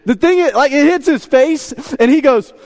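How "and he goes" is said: ordinary delivery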